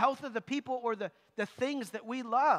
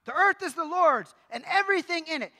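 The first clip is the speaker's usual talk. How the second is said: the verse called out louder and higher; louder each time; 5 semitones higher, 7 dB louder than usual. Retold or imitated. Pastor repeats the verse louder until they catch on. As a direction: press it home, louder. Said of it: "read the verse louder and louder".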